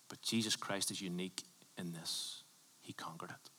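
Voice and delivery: hushed, reverent whisper. Real, not acted. Preacher whispers to the congregation about Jesus, hushed. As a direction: drop to a whisper for weight. Sermon point whispered for effect.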